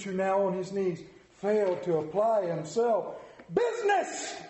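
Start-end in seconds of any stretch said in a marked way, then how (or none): 3.5-4.4 high, anguished Business; anguished cry. A narrator's reading. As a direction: wail the word out. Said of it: "cried the ghost".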